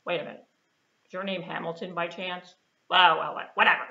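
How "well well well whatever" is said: usual speaking voice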